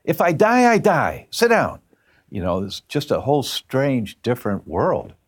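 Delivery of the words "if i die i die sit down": high-pitched retold aunt ordering everyone down; exasperated command. Aunt waving off fussing relatives, exasperated. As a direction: order them down in a high, insistent voice.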